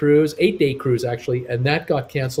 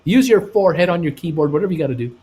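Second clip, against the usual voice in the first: higher, louder rallying chant to viewers; hype urging; 6 semitones higher, 3 dB louder than usual. Real, not acted. Host urging livestream viewers to like, excited and hyped. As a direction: pump up the crowd to click. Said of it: "Please hit that thumbs up button".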